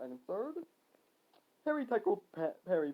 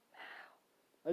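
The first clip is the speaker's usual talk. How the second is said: hushed voiceless whisper; 16 dB quieter than usual. Acted. Unseen voice near the hero, whispering. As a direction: whisper the signal. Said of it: "I heard a voice whisper".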